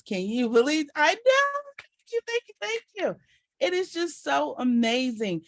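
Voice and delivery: high, bright squealing thanks; excited squeal. Real, not acted. Thanking the team after sharing her weight loss, squealing happily. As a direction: gush with joy at the applause.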